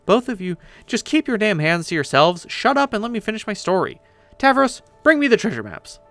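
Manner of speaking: high-pitched, fed-up ordering; bossy scolding. Acted. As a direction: lay down the law after interruptions. Orders the squabblers to be quiet.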